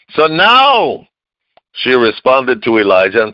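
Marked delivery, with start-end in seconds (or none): 0.1-1.1 sudden high lift. Acted.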